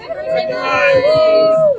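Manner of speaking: high, loud birthday shout; shouted cheer. Real, not acted. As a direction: yell it out with joy. Louder repeat of birthday cheer.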